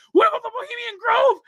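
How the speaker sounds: high shouted repeated demand; retold screaming. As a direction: yell it at him with the pitch way up. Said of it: "screaming at him".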